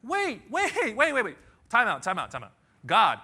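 high, loud, frantic stop call; panicked protest. Acted. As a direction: stop everything, it makes no sense. Elijah panicking after the threat.

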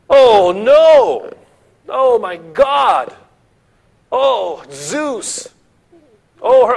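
wailing in despair, high and loud; loud, high cry of horror, hammed up; paniekerig gejammer. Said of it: "he's wailing, he's weeping".

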